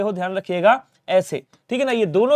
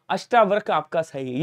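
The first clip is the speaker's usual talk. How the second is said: ordinary delivery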